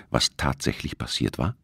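usual speaking voice

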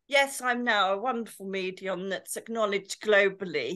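pompous posh English medium; mock posh. Medium parodies what colleagues expected, mock posh. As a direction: put on self-important posh airs. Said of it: "We really thought you'd be posh".

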